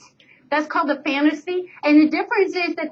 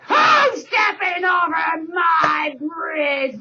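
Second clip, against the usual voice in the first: troll roar; 4 semitones higher, much slower than usual. Acted. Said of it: "roared the troll".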